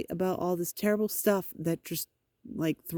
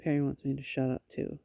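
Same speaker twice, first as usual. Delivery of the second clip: ordinary talk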